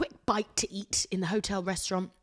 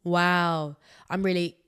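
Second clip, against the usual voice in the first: usual speaking voice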